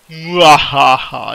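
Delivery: slow, loud villain laugh; evil cackle. Acted. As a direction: gloat with a drawn-out cackle. Gloating over finding a rifle.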